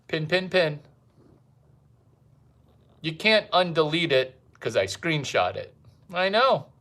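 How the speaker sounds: ordinary delivery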